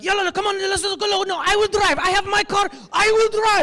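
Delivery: high, eager, overexcited voice, with a different timbre. Retold or imitated. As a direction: rush everyone out the door, pumped up. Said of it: "Arabs get excited about everything".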